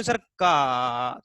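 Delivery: का said slow and low, the vowel आ drawn out; slow low demo. Shown as a demonstration. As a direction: stretch the आ in का. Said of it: "उसमें स्वर छिपा हुआ है". (का को धीमे, नीचे स्वर में खींचकर बोलना)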